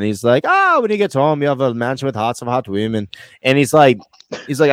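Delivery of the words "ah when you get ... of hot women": dodgy Irish brogue; comic Irish accent. Acted. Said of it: "don't even know if that's Scottish or Irish".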